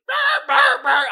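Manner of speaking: loud high yelping barks; dog barking. Pretend. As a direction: bark like a dog in heat. Imitates dogs barking.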